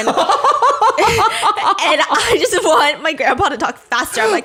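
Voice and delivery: high, loud laugh through the words; cracking up mid-sentence. Real, not acted. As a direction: barely get it out for laughing. Cracking up while retelling.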